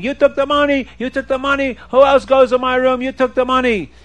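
high-pitched accusing cry; storyteller's mild version of screaming; mock screaming. A narrator's reading. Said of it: "the buyer, is screaming".

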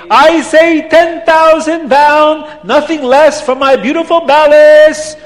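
high, loud auctioneer's bellow; acted angry, accented sales cry; angry Abdallah. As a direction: call it out indignantly, stretching the ends. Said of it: "Abdallah starts to get angry".

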